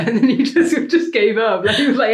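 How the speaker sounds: high, loud, laughing through the words; can barely get it out for laughing; laughing while telling. Real, not acted. Teller cracking up mid-story.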